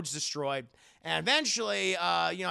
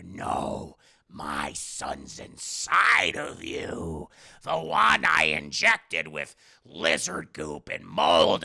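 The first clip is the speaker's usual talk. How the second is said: much lower and louder, slow and heavy; booming father; 3 semitones lower, 6 dB louder, slower than usual. Retold or imitated. Father tells his son the real son is inside, low booming and slow. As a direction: preach it from deep in the chest.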